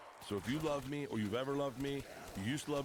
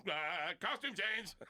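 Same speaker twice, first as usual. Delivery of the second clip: drawn-out plea; 7 semitones higher, much slower than usual. Retold or imitated. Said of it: "And then I'm like".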